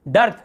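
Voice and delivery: डर्थ, rhyming with "earth"; neutral uh vowel. Shown as a demonstration. Teacher modelling EA as uh for students, डर्थ with "ur". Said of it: "इसे हम केवल अ पढ़ रहे हैं".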